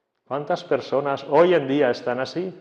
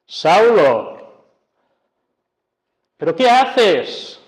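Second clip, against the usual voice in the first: a rebuking divine voice, raised; llamada enérgica; 6 semitones higher, 5 dB louder, slower than usual. Acted. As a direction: call out Saulo, then ask high and slow. Jesús interpela a Saulo. (Jesús llama fuerte a Saulo, más agudo)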